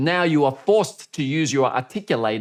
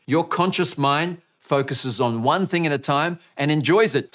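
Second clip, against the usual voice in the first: ordinary talk, nothing marked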